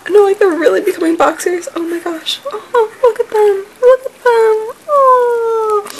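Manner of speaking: very high squeal; gushing excitement. Real, not acted. Vlogger marvelling at the new boxers, high gushing squeal. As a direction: coo and squeal, stretching into a long high "oh".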